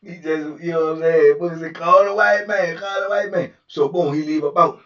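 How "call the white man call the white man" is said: repeated order. Retold or imitated. Booker ordering again.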